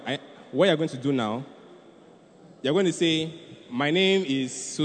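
ordinary talk

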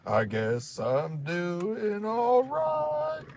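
slow, high crooning country singer; breathy vintage purr. Pretend. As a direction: drawl it like an old crooner. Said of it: "[name]".

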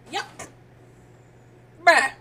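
ordinary delivery

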